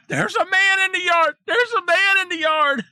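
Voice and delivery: high, loud hammed-up wail; exaggerated frightened yell, pitched very high; mock panicked shout. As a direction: scream it like a terrified wife. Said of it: "And she's like".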